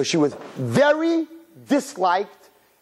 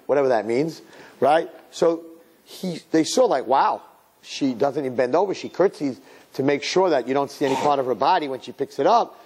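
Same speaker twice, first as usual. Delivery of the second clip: ordinary talk, nothing marked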